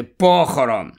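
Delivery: ordinary delivery, nothing marked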